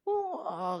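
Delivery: high, soft imitated wail; mock wailing. Preacher showing how bereaved families wail, mock wailing. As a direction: mimic a drawn-out mourner's cry, kept quiet. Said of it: "kemudian dia menangis-nangis".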